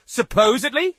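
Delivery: outraged and squeaky; high offended echo; shrill, indignant. Acted. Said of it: "he shrilled indignantly".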